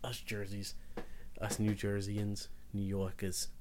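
soft, low, slow attempt at a New Jersey accent; New Jersey accent slipping into other accents; botched New Jersey accent. Podcaster mimicking New Jerseyans for his co-host, wobbly New Jersey accent. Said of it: "I slipped between three different accents there".

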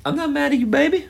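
high sweet voice for the dog; pet talk. Real, not acted. Soothing dog that jumped up.

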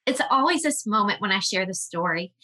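usual speaking voice

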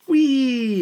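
high, gleeful squeal; head squealing with delight. Retold or imitated. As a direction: squeal like you're on a ride. Agatha having fun, pulled from water.

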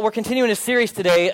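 ordinary delivery

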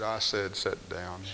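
low, quiet teacher's order; mock a strict teacher, softly; stern quiet command.

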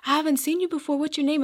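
sweetly welcoming, voice lifted high; retold friendly greeter. Churchgoer greeting a newcomer, retold friendly welcome. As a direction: imitate an eager welcomer, high-pitched.

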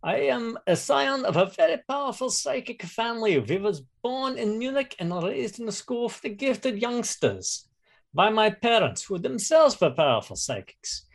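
put-on lightly German-accented psychic noble; proud scion in a mild German accent. Player introduces his German character.

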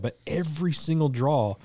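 ordinary talk, nothing marked

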